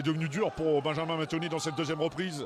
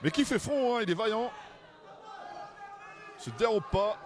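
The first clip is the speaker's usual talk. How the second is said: excited; 8 semitones higher than usual. Real, not acted. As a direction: shout the boxer's courage. Boxer counted twice, still fighting.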